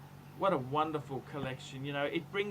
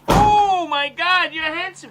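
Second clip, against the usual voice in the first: high, loud gushing over his looks; mock gushing; 12 semitones higher, 14 dB louder, slower than usual. Host gushing at a handsome guest, hammed-up and loud. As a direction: swoon over him, hammed up.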